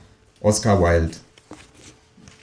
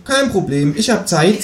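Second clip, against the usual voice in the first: much higher chatty kangaroo neighbour; a cheeky kangaroo asking for eggs; 11 semitones higher than usual. Acted. Kangaroo voiced in read story. (das Känguru bittet um Eier, mit hoher Stimme)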